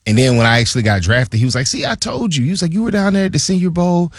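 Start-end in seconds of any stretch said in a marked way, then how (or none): 1.7-4.1 much higher, teasing GM voice; mock GM voice. Player imitating his GM gloating about the draft, high and teasing. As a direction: pitch it way up as the gloating GM.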